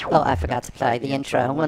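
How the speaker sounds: ordinary delivery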